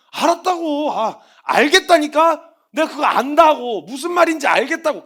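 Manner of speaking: loud, high, fed-up "I know"; irritated retort. Retold or imitated. Believer snapping back at the preacher's advice. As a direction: snap back impatiently. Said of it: "계속 말만 하고".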